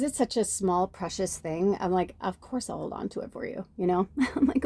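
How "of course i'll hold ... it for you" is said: soft whisper of a promise; hushed, imitating own promise; whispered reassurance. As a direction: breathe it gently like a secret.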